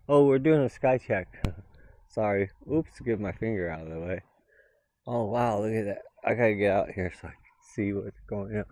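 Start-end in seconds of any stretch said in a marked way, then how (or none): none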